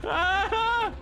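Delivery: high, frantic cries; high panicked voice. Acted. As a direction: squeal for help up high. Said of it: "apparently I need to do the whiny voice".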